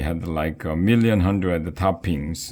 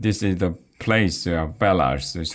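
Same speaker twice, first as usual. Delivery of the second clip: plain reading voice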